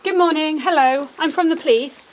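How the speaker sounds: loud, high call through a door; apologizing through a door. Real, not acted.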